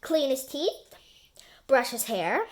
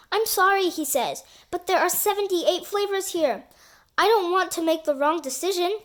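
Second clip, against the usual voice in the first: fretful Terry; 6 semitones higher, 4 dB louder than usual. Acted. Terry fretting over flavors.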